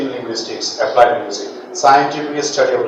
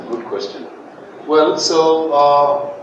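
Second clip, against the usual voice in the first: ordinary delivery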